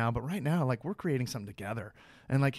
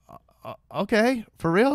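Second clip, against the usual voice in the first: surprised; 8 semitones higher, 6 dB louder than usual. Retold or imitated. Stunned by the director's suggestion.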